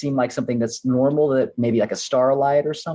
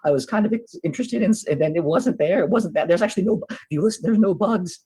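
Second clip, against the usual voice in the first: high, rambling character voice; worked-up little watchman voice; excitable lookout; 5 semitones higher than usual. Acted. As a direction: insist on what you saw, pitched up. Player in character describes the sighting.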